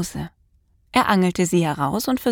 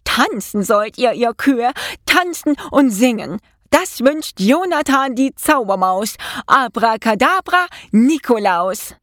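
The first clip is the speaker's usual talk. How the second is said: high, declaimed incantation; called-out spell; 4 semitones higher than usual. A narrator's reading. Mouse orders the cows to dance, commanding spell. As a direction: call the magic words out, pitched up. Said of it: "rief er zu".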